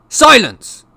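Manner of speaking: explosive high, loud command; yell. A narrator's reading. Uncle cutting off nephew, furious yell. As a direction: yell it to shut him up. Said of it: "yelled Uncle Vernon".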